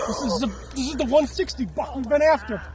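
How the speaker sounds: high, loud, excited exclaiming; thrilled. Real, not acted. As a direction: blurt it out high, overjoyed at the prize. Hunters celebrating over the big buck.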